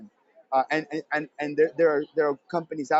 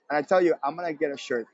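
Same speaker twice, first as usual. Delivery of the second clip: ordinary talk, nothing marked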